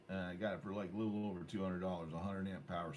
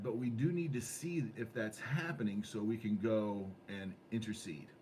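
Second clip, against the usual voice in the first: ordinary delivery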